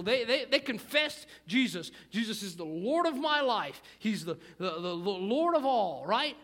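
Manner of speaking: loud, high-pitched sermon urgency; forceful preaching. Real, not acted. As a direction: drive it home to the congregation at full voice.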